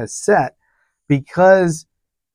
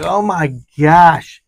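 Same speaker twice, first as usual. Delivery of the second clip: rising pitch, louder, a panicked rant about the unsafe taxi; 4 semitones higher, 3 dB louder than usual. Retold or imitated. Passenger to herself about the sleepy driver, panicked and climbing high. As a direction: spiral into alarm, voice climbing higher and louder. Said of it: "And I'm like".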